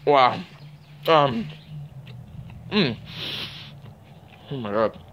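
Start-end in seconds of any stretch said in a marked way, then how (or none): none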